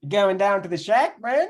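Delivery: drawn-out mock Texas accent; Southern American accent. Shown as a demonstration. Friend attempting the Texas accent for a laugh, Southern American accent. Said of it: "how to even put that on".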